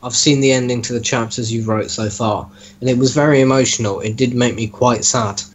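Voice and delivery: usual speaking voice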